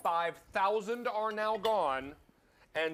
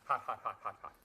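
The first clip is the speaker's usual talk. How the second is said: mouth full of hot food; 8 semitones lower, 4 dB quieter than usual. Real, not acted. Bite of just-cooked bananas.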